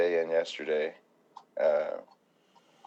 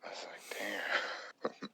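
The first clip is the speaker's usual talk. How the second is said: awed whisper; 13 dB quieter, much slower than usual. Real, not acted.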